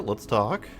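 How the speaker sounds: usual speaking voice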